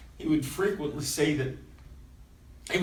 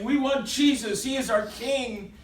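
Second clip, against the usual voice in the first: higher-pitched supporters' chant; lightly voiced crowd call; 6 semitones higher than usual. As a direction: call out as a smaller rival crowd. Voicing the rival crowd's call.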